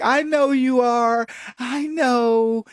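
slow, drawn-out mock judge. Host imitating judge answering the crying defendant, slow and mock patronising. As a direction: let it drag, patronising. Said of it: "the judge is like".

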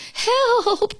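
high, drawn-out cry for help; alarmed cry. A narrator's reading. Toad cries out as his list blows away, alarmed. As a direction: call out in panic, voice way up. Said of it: "cried Toad".

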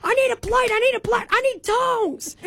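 high, calling out for tongs; barbecue dad yelling. Retold or imitated. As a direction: holler for plates like a busy griller. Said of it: "it's him yelling at the door".

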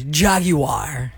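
Jaguar as "JAG-you-ar"; three careful syllables in Jaguar; required ad pronunciation. Shown as a demonstration. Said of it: "Not Jaguar".

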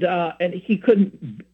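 ordinary delivery

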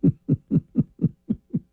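real breathy, voiceless laughter; helpless laugh with hardly any voice; wheezing laugh. Real, not acted. Storyteller cracking up at the bacon story, wheezing laughter.

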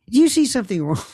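incredulous question, pitched high; mock incredulous. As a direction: ask it like you can't believe your eyes.

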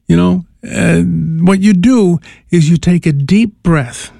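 ordinary talk, nothing marked

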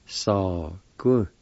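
"Sa Ku" as two separate, held syllables; syllable-by-syllable pronunciation. Shown as a demonstration. Teacher showing learners the first part, "Sa Ku" syllable by syllable. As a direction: sound out "Sa Ku" slowly. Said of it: "First part's pronounced like this".

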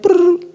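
high rolled "brrr" of cereal pouring; imitated pouring noise. Mom pouring a little cereal into a container, imitated trill. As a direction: trill a short high rattle. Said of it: "pours it in there, like".

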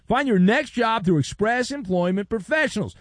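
radio ad read. Acted. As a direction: sell it like a radio host's promo. Host reads a sponsor ad.

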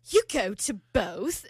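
put-on slow, incredulous British accent. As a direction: imitate a baffled British man. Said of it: "[name]'s doing with the accent".

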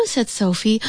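plain reading voice

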